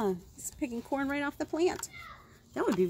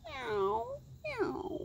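much higher, soft mewing; cat meow; 12 semitones higher, 5 dB quieter than usual. Acted. Reading to kids, voicing the cat, high soft meow. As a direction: mew it like a little cat. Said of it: "said the cat".

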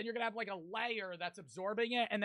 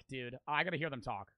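normal talking voice

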